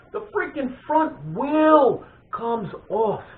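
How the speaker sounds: high, drawn-out storyteller's alarm; slow, hammed-up shock at a disaster; dramatic disbelief. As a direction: stretch each word in high-pitched disbelief. Climax of the wheelie mishap.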